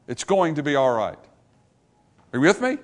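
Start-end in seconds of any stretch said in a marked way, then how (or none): none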